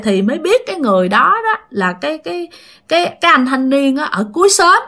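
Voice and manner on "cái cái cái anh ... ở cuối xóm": usual speaking voice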